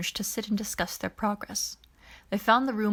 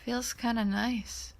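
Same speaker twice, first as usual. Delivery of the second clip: slow, soft, dreamy mutter; misty mutter; 3 semitones higher, 5 dB quieter, slower than usual. A narrator's reading. Keith having his hair rubbed, slow soft dreamy mutter. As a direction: drift off as if half asleep. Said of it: "Keith muttered mistily".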